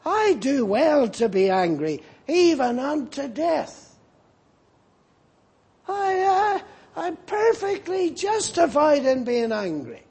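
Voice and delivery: a stubborn prophet insisting he's right; defiant Jonah. Acted. Jonah answers God defiantly.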